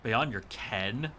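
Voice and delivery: slow, questioning repeat; incredulous echo. Acted. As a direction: echo it slowly in disbelief, rising on "ken". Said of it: "Who the fuck talks like this?".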